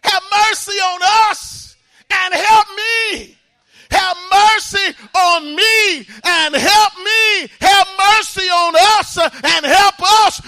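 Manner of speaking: high, loud, pleading cry to the Lord; shouted plea for mercy. Acted. Mother begging the Lord for her child, loud pleading cry. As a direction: cry out for mercy with everything you have.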